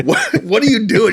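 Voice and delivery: shocked, laughing disbelief; incredulous. Retold or imitated. Sees buddy standing naked.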